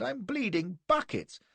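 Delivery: high, slow, plaintive wail; groaning complaint. A narrator's reading. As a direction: play up the pain, drawn out and high. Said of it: "I groaned".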